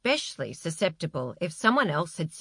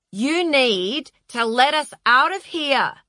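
higher, louder plea to be let out; raised, insistent voice; shouted demand; 7 semitones higher, 5 dB louder than usual. A narrator's reading. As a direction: call out urgently to your captor. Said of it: "shouted Jessica".

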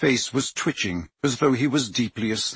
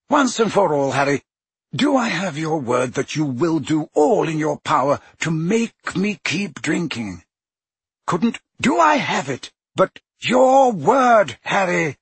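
put-on old wizard pressing hard, rising on your word; insistent Dumbledore; 6 semitones higher, 4 dB louder than usual. A narrator's reading. Headmaster demanding Harry's word, insistent. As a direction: demand his promise firmly.

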